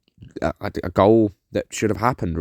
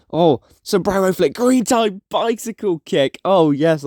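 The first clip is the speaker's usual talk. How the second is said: excited; 8 semitones higher, slower than usual. Real, not acted. Celebrating slick skill move.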